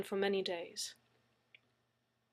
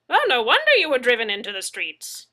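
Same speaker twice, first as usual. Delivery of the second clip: put-on higher, much louder eccentric old man; Mad Zeke voice; 4 semitones higher, 13 dB louder than usual. A narrator's reading. Mad Zeke teasing Leslie, loud and eccentric. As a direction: boom it out like a wild hermit.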